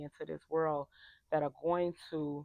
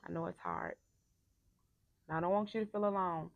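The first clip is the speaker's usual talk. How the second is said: gentle reassurance. Real, not acted. Comforting client, drop in voice.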